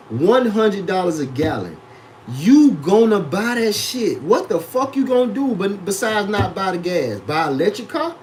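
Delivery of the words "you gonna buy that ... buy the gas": ordinary talk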